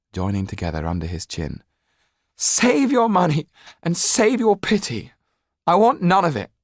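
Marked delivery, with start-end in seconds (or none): 2.4-6.5 much higher, louder shout. Acted. Jan shouts at the Laxtons rejecting their help. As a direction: yell through tears. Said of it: "Jan was shouting now".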